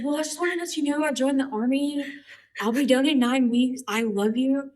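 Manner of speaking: high-pitched, sing-out reassurance to mom; acted high voice on a call home; retold phone confession. Retold breaking news to mom by phone.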